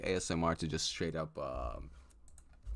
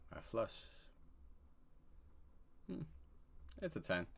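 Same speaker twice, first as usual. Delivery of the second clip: ordinary talk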